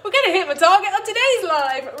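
high, loud, tearful excitement; overjoyed. Real, not acted. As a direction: squeal it through happy tears. Overjoyed at nearing donation target.

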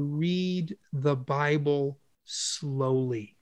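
slow down on "read the Bible slowly"; drawn-out slow. Shown as a demonstration. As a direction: let "slowly" stretch out, low and soft. Acts out reading slowly.

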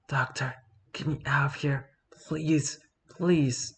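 frantic whisper, pleading. Acted. Terrified prisoner whispers to the doctor, pleading whisper. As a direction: whisper so the thing can't hear you.

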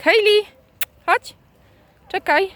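high, loud call to the dog. Real, not acted. Calling the dog. (wołanie psa wysokim głosem)

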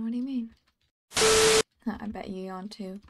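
ordinary delivery

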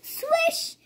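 squeaky high swish; magic swish. Acted. Child reading to parent, high magic swish.

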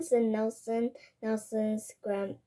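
plain reading voice

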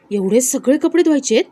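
loud, disbelieving question; dismayed surprise. A narrator's reading. दिव्याला कपड्यांचा ढीग मिळतो. (अविश्वासाने, मोठ्याने विचारलेलं)

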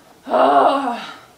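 big loud exhale sigh; loud release sigh. Shown as a demonstration. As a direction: breathe the anger out in one heavy sigh. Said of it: "remember to breathe".

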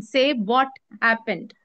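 normal talking voice

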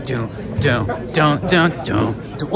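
chanted doom doom as he weaves through the crowd; humming a beat. Acted. Vlogger weaving through a crowd, humming a beat. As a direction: hum a silly march beat under your breath.

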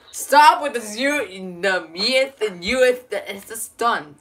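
exasperated yell. Real, not acted. Yelling at a friend to drop the dead memes, exasperated. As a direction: shout him down, you've had enough.

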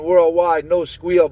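usual speaking voice

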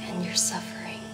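hushed, tempting whisper; dark whisper. Acted.

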